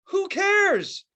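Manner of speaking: normal talking voice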